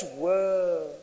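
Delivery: breathy awe; a hushed wow of amazement; amazed whoa. Real, not acted. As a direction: say it like you're stunned. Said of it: "do you hear the wows".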